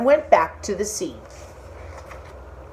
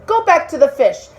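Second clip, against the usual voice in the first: high, louder bossy wife; demanding wife; 7 semitones higher, 4 dB louder than usual. Acted. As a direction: order him off to the sea.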